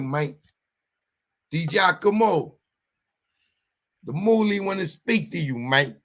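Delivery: put-on mobster with punchy stresses; mock Italian mobster. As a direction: play a wiseguy warning a paisan. Plays a mobster threatening.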